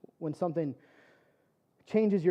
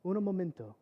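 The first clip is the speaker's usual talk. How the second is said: put-on soft Spanglish-accented reassurance; hushed, soothing voice with a Spanglish accent; 3 semitones lower, 11 dB quieter than usual. Anesthesiologist calming patient in Mexico, gentle Spanglish accent. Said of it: "in his kind of Spanglish accent".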